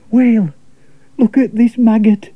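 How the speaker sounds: lightly voiced boy calling to the whale; Maurice, eager angler. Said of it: "cried Maurice".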